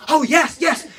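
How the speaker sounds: suddenly keen, perking up; eager yes. Retold or imitated. Joe to his buddy, mock-eager.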